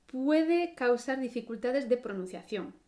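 ordinary talk

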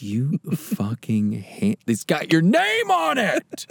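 hammed-up outraged father; angry dad. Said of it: "he was like".

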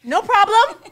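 high, sing-out acted acceptance; feigned nonchalance. Acts out reply to being refused.

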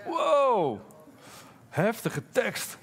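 high wow, then drawn out slowly; awed wow. Real, not acted. Preacher reacting to a harsh Bible verse, awed and slow. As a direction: let the hard text land with a slow wow. Reacting to Jesus' hard words.

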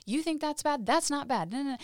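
high, dismissive mock scolding; hardcore retort. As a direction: scoff like a girl who has seen worse. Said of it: "they were hardcore".

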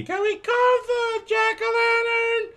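very high, slow child impression; hammed-up kid pestering loudly; annoying kid voice. Kid asking Dad about the pumpkin, drawn-out whine. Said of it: "His annoying ass kid".

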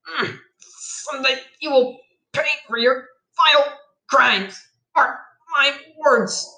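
high, halting, strained words; wounded vow. A narrator's reading. Shot child vowing revenge on bandit, strained halting voice. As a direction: force out each word separately, as if badly hurt.

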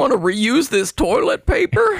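hammed-up hillbilly. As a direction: talk like a local country boy. Alien switches to hick voice.

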